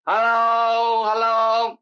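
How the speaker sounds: loud, high, long-drawn call; shouted hello. Acted. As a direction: holler it out as if to someone far away. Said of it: "If you just shout".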